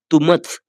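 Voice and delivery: तुमच without the nasal ending, marked as wrong; तुमच cut off flat, no nasal sound at the end; wrong pronunciation. Shown as a demonstration. Teacher shows learners the wrong तुमच, without the nasal end. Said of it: "तुमच नहीं पढ़ना है उसको".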